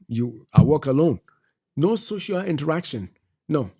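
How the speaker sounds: normal talking voice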